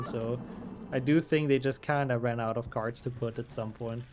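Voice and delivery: ordinary delivery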